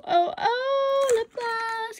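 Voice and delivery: high, thrilled little squeal; excited squeal. Real, not acted. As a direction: gasp with joy. Spots lip gloss.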